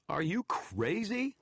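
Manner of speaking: can't believe what he's hearing; wizard, stunned, demands of the shadow. Acted. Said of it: "I demanded, stunned".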